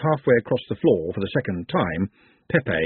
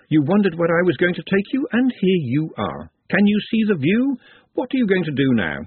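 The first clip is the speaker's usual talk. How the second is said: lightly voiced, higher-pitched tempter; talking sherry; 7 semitones higher than usual. The sherry prodding Glenda, lightly voiced and teasing. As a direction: pitch it up as a goading inner voice.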